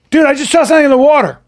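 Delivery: high, loud, rushed warning; retold alarmed kid. Kid warns friends about the water, alarmed. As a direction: blurt it fast and high in mock alarm. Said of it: "So the kid goes".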